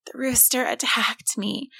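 frightened breathless whisper; barely getting the words out, whispered. A narrator's reading. Scared boy whispers to his mother. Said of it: "Tim found that he could manage a whisper".

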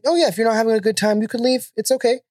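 high, fast polite reassurance; customer service voice. Retold or imitated. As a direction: cheerfully insist they can leave. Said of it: "Best customer service voice".

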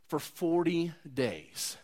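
slow, soft and stretched out; drawn-out emphasis. Acted. Preacher dwelling on the long wait, slow and hushed. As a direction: let the words linger quietly.

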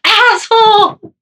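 very high, loud, slow shout; yelling down. Retold or imitated. Retelling yelling the nickname down the hall in a loud high shout. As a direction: bellow the word down the hall. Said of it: "I was yelling down to her".

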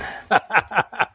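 short amused laugh. Real, not acted. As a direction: laugh at your own joke. Laughs at own accent joke.

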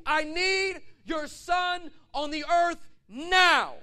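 huge slow booming shout; God's yell. Retold or imitated. Preacher voicing God yelling at him. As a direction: boom it out like a voice from heaven. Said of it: "he repeated it with the same amount of intensity".

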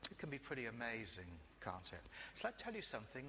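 ordinary delivery

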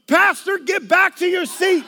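loud high barked command; shouted order. Retold or imitated. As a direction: shout him back to his seat. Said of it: "she goes".

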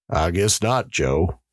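ordinary delivery

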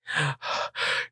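unvoiced startled gasp; sharp gasp. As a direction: gasp in surprise. Officer finding the lost child.

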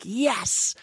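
a little celebratory yes; host recalling the fist pump after the story, mock cheering; imitating a cheer. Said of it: "I'm like".

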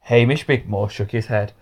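plain reading voice

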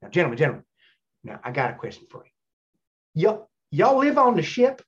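imitated Southern man; Southern accent. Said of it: "I do the Southern accent".

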